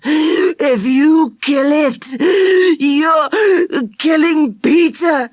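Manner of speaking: slow, high and loud through short breath; choking urgency. Acted. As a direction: push every word out against choking. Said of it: "Charlie was choking and fighting for breath".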